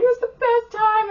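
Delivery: high, loud gushing imitation; squealed mock enthusiasm; mock excited. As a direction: imitate someone thrilled on the phone. Voicing happy caller while she is overwhelmed.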